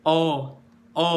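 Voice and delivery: "A" thickened into a heavy "O". Shown as a demonstration. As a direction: lift the back of the tongue on "O". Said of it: "Lidahnya naik".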